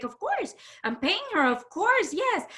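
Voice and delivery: high, bright eager yes; mother's eager reply. Retold or imitated. Mother agreeing eagerly to her guest, voiced high. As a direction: chirp it out like an eager host.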